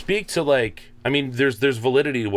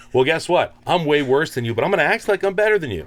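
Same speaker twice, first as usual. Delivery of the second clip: defiant rant; 7 semitones higher than usual. Retold or imitated. Acting out his defiant attitude.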